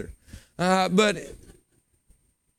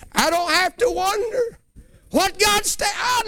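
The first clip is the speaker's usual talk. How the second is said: preaching cry. Real, not acted. Preacher building to full force.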